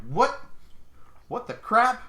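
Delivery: high, loud disbelief; shocked outburst. Retold or imitated. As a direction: cry out What the crap in shock. Shocked wife agreed to dog.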